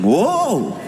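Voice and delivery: high startled yelp, laughing; falling off balance; startled whoa. Retold or imitated. Imitating falling on escalator.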